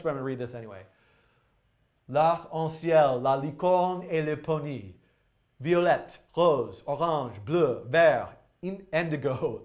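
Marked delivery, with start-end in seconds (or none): 2.1-9.6 put-on slow, halting amateur French accent; terrible French accent. Live streamer reads a translated poem to viewers, terrible French accent. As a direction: read it out in bad French, word by word. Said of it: "with my terrible French accent".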